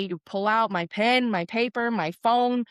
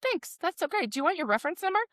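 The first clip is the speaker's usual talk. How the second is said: high, soft cheerful agent voice; sweet upbeat customer service; chirpy agent; 8 semitones higher, 4 dB quieter, much faster than usual. Retold or imitated. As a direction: Juan chirping back the reference offer. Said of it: "He's like".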